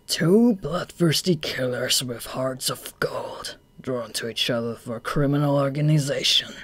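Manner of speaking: slow breathy sneer; mock them in a hushed sneer; scoffing. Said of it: "scoffed Akutagawa with a subtle laugh".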